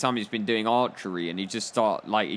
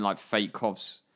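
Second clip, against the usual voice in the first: ordinary delivery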